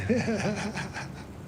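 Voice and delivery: short high-pitched laugh at the line; high breathy giggle. Real, not acted. Vlogger giggling at a chat pickup line, amused.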